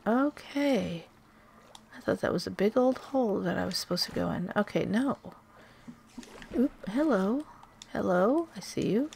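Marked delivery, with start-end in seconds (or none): none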